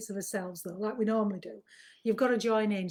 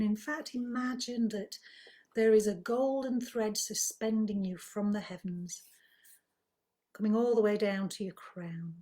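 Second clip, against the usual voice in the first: guided meditation; 4 dB quieter than usual. Acted. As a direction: ease listeners gently into calm, softly and unhurriedly. Leads a breathing relaxation.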